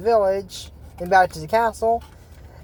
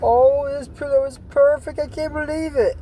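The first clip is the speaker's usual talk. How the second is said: raised high, thrilled over the pillow; a thrilled little bunny, pitched way up; high delighted bunny; 8 semitones higher than usual. Retold or imitated. Bunny delighted with his new pillow, high and thrilled. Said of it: "he goes back and goes".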